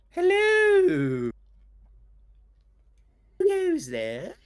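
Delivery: very high, loud, drawn-out hail from afar; gentle voice calling out. Acted. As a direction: call across the water in a high character voice. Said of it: "this gentle voice call out".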